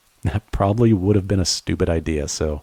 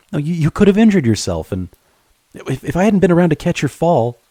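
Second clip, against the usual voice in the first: much higher, agitated, stammering; worried scolding; 9 semitones higher than usual. Acted. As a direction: scold her out of fright for her safety. Scolding after near fall.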